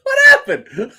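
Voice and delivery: laughing. Real, not acted. Laughing at dog on stream.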